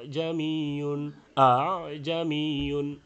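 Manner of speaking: জামিয়ুন slowly, with the hamza eased halfway toward alif; in between hamza and alif. Shown as a demonstration. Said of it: "এর মাঝামাঝি হবে".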